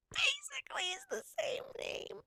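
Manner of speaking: high, breathy confiding whisper; whispered aside. Real, not acted. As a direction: whisper it like a secret.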